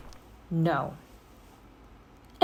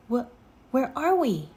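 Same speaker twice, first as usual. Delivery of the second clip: startled stammer, a little higher; 6 semitones higher, 4 dB louder, faster than usual. A narrator's reading. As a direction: Jack stammers in shock at the strange land, startled. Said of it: "stammered Jack".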